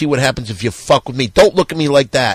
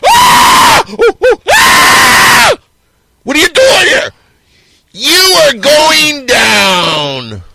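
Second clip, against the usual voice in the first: shrieking high, loud wrestler-monster cry; piercing falsetto battle shriek, slowing right down on the last line; monster screech; 13 semitones higher, 6 dB louder, much slower than usual. Acted. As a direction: screech it out like a deranged ring villain. Stone Fury character enters screaming.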